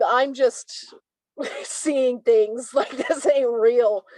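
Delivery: laughing through the words in disbelief; half-laughing, brushing it aside as not real. Retold or imitated. Telling the host she doubted the vision, laughing.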